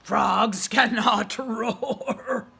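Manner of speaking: slow, loud lion voice with a laugh; the lion chuckling at Freddy. A narrator's reading. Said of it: "said the lion".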